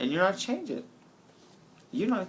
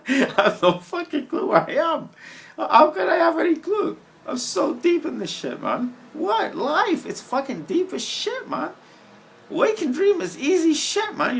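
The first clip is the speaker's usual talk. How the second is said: much higher and louder, half laughing; giddy and loud; 12 semitones higher, 7 dB louder than usual. Real, not acted. High on a night walk, rambling giddily to camera.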